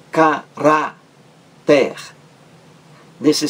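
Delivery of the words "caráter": "Caráter" muito lento, em ca, rá, ter; separação silábica. Shown as a demonstration. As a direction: separe "Caráter" com pausas entre as três sílabas. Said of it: "da penúltima sílaba". ("Caráter" escandido sílaba por sílaba)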